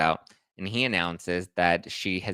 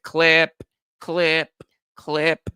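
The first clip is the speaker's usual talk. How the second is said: louder, higher snipping clips; mock clipping; 7 semitones higher, 8 dB louder, much slower than usual. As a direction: snip her off with gusto. AG imitating cutting.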